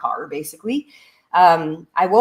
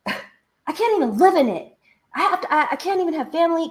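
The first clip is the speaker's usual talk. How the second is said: imitating friend; 9 semitones higher than usual. Friend explaining the condo problem.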